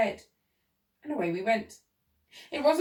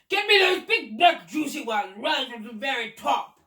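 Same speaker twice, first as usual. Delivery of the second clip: put-on loud, high, imperious old man; shouting; 4 semitones higher, 7 dB louder than usual. A narrator's reading. Old man insists on the topmost cherries, shouting. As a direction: shout the order up to the treetop. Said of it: "the old man was shouting".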